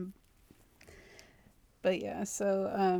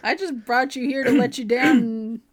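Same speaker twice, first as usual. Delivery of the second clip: imitating the date letting her down easy; mock date voice; 3 semitones higher, 7 dB louder than usual. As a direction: play the smug dumper. Voicing the date breaking up.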